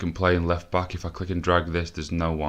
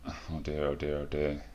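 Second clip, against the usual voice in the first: dejected; 2 semitones lower, 9 dB quieter than usual. Real, not acted. Dejected after result.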